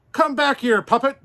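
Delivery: high, loud call after the puppet; yelling come back; crying out. Acted. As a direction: shout after the runaway. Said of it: "Geppetto cried".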